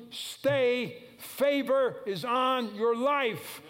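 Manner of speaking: high, loud, slow exhortation; preaching at full force. Acted. Preacher urging his congregation not to give up, at full force. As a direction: drive each phrase home to the church.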